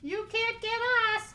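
high, mocking goat taunt. A narrator's reading. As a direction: jeer at the troll in a high voice. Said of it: "And they laughed at the troll".

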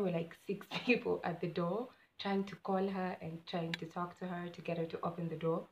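plain reading voice

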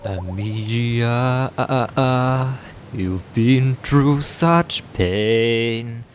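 slow, drawn-out mournful line; slow dramatic voice. Pretend. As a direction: stretch every word out tragically. Dramatic quote voice.

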